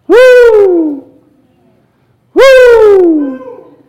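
high, loud joyful whoop; joyful woo shout. Real, not acted. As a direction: whoop with joy to the rafters. Praise shout.

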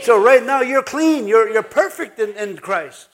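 emphatic revival-style preaching; full-force preaching. Real, not acted. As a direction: preach it out to rouse the congregation.

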